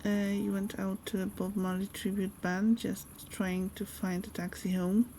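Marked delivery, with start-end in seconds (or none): none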